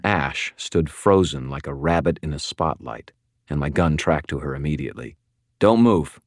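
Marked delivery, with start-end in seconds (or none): 5.6-6.2 loud, higher, firm warning; sharp, forceful order. Acted. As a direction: warn someone at gunpoint. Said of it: "I warned her".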